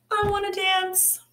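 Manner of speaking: put-on high, longing little-girl voice for Bronterina; teacher voicing Bronterina to the class, high and dreamy; dinosaur's dream voice. Dinosaur voiced in read-aloud.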